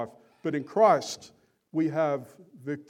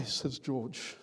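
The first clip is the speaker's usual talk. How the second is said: ordinary talk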